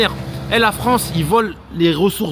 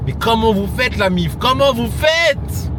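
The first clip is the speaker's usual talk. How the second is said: drawn-out, much higher cry of disbelief; slow, high amazed question; stunned disbelief; 7 semitones higher, slower than usual. Real, not acted. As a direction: stretch it out, baffled.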